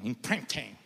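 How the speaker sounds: "imprinting" in a low, soft English accent; English pronunciation. Shown as a demonstration. Preacher repeating the foreign term, soft English accent. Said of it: "En inglés es imprinting".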